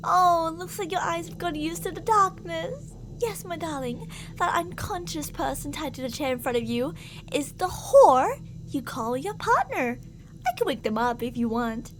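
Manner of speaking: a possessive captor's sweet, menacing voice; obsessive lover. Acted. Captor sweet-talks the darling they kidnapped, creepy and possessive. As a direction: croon to your darling while threatening the captive.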